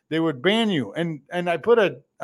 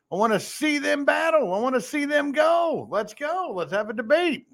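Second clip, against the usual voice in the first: excited; 7 semitones higher than usual. Real, not acted. Hyping up a candidate fight.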